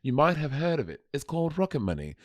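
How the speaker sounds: a light put-on British accent in an ad read; mock British accent. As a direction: hams up a British voice, softly. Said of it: "I'll do British show".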